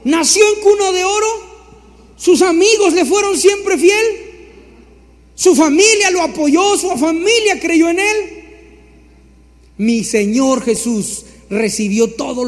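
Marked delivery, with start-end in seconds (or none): 0.0-8.4 high, loud mocking questions; sarcastic high mock. Preacher mocking the idea that Jesus had it easy, sarcastic and high. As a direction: sneer at the idea it was easy.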